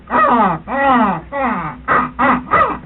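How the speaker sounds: loud, repeated cawing; harsh crow-call imitation; common crow call. Shown as a demonstration. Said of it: "a common crow goes".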